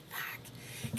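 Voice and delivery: breathy whispered swear; whispered curse. Retold or imitated. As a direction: whisper it under your breath. Quoting her reaction, unvoiced.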